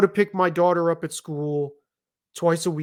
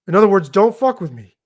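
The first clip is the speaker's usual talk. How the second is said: louder retold warning; totally serious; 2 semitones higher, 7 dB louder than usual. Adult summing up a warning to boy, serious and louder. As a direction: drive the threat home firmly. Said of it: "I was totally serious".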